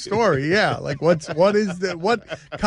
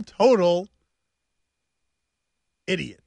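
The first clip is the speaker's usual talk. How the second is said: ordinary talk, nothing marked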